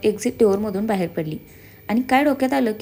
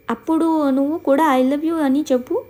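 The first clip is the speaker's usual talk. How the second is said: higher, slow, delighted question; happy Hiranya; 6 semitones higher, 4 dB louder, slower than usual. A narrator's reading. Hiranya urges Diksha to say it back, happy. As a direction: tease her friend happily. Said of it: "हिरण्या खुश होत म्हणाली".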